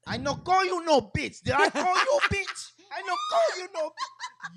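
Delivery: high, loud mock Mexican protest; hammed-up broken-English denial; Mexican accent. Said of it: "He was like".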